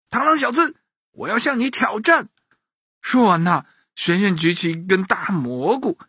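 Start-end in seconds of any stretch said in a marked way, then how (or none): 0.1-2.3 put-on high-pitched, eager boy's challenge; excited boy challenger. A narrator's reading. Boy challenges the mantis, high and bold. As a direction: call out a challenge in a high child's voice.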